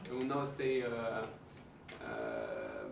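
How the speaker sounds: ordinary talk, nothing marked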